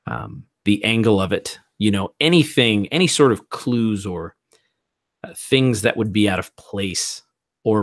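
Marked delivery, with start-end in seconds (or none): none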